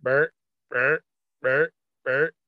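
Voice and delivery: imitating a deer's grunts; deer grunt imitation. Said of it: "ever heard a deer grunt".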